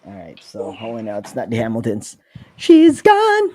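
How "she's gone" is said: very high squeaky chipmunk voice; pitched way up like a cartoon chipmunk. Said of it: "You sound like a chipmunk".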